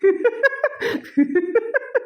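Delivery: long high-pitched giggle; high tittering laughter; amused laugh. Acted. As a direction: giggle away before the reveal. Laughing before revealing her ear.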